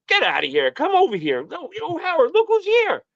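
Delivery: high, hammed-up showman call; imitating Don. Agent steering him to Howard's table, rising high. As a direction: build up to a high fanfare. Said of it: "And Don was like".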